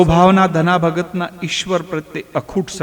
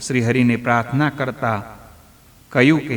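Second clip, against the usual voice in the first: plain reading voice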